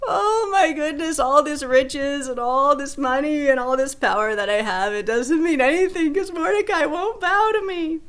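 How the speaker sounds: high, loud, whiny complaining wail; whiny Israelite voice. Retold or imitated. Pastor voicing Haman griping to his family, high loud whiny wail. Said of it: "my whiny Israel voice".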